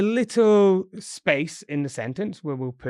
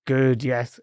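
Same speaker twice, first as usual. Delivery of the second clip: normal talking voice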